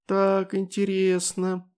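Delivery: high, slow, thin voice; a tiny, curious creature voice; thin little voice. A narrator's reading. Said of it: "раздался тоненький голосок".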